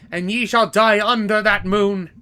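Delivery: ordinary talk, nothing marked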